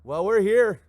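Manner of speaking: van people. Retold or imitated. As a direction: shrug it off as the ever-present van. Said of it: "It's like".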